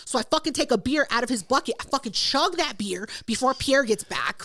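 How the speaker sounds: normal talking voice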